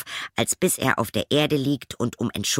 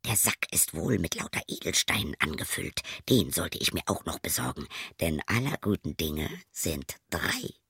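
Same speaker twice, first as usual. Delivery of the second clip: gedämpft gierig murmelnd; leise zu sich; 5 semitones lower, 6 dB quieter than usual. Acted. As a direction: heimlich für sich überlegen. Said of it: "fragte er sich". (leise Gedanken des Wirts)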